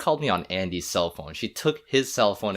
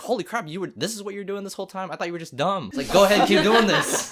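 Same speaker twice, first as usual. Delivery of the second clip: much higher, excited mom; amazed mom; 12 semitones higher, 5 dB louder than usual. Retold or imitated. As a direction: gush like a surprised proud mom.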